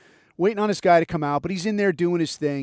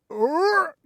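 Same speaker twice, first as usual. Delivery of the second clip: high-pitched squeal of confusion; comic stuck noise, pitched way up; high startled "er"; 12 semitones higher than usual. Retold or imitated. Man caught out on the phone, high startled noise.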